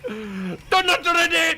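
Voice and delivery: high, slow, loud parody Indian accent; mock "Canadian accent". Shown as a demonstration. Streamer demonstrating the "Canadian accent" joke, shouted Indian-accent parody. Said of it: "That's the Canadian accent".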